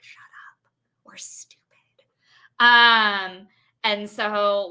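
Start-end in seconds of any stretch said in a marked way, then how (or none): 0.0-1.6 put-on secretive kids' whisper. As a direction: whisper it like a kid afraid of being caught. Kids at recess saying forbidden words.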